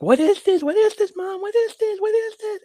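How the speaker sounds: put-on shrill-pitched child nagging over and over; little girl. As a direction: repeat it like a pestering little girl. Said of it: "the little girl next to him is like".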